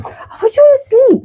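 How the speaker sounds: high-pitched disbelief; incredulous retort. Retold or imitated. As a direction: question the child in astonishment. Surprised retort to the child.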